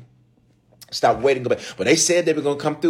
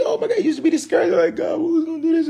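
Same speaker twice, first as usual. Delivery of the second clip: exasperated high cry; faster than usual. Retold or imitated. Pastor recalling his old discouragement, exasperated high cry. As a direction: pitch leaps way up in despair, fast.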